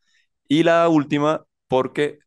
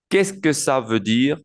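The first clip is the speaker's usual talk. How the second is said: whole phrase linked, measured pace; joined-up model; 2 semitones higher than usual. Shown as a demonstration. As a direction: run the words together clearly for learners. Teacher repeats the phrase joined up.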